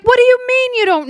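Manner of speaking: high, incredulous retold outburst; mock mad. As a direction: imitate him demanding an answer. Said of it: "he's, he's really mad".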